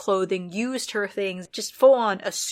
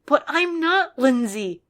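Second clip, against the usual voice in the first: insisting; 6 semitones higher, 3 dB louder, slower than usual. A narrator's reading. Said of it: "she insisted".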